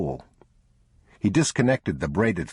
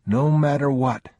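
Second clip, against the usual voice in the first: put-on higher, louder child's echo; young boy; 8 semitones higher, 4 dB louder than usual. A narrator's reading. Boy promising his father not to let go. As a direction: repeat it like a solemn promise from a small boy. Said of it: "the boy said".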